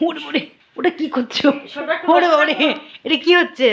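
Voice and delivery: high laughing "what's that!"; surprised. Real, not acted. Baby doing something unexpected.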